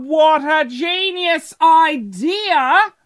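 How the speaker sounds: high, drawn-out gush of delight; gushing enthusiasm. Real, not acted. Podcaster raving about a birthday cheese platter, gushing enthusiasm. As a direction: stretch it out high in sheer admiration.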